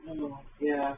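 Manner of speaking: ordinary talk, nothing marked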